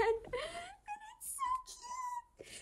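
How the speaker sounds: very high, slow, breathless whispered coo; whispered squeal. Real, not acted. Fan overwhelmed by a cute anime moment, whispered squeal. Cooing over a cute clip.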